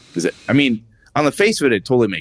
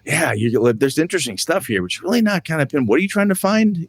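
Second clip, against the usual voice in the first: exasperated prodding; 9 semitones higher than usual. Retold or imitated. Acted game master pressing his son.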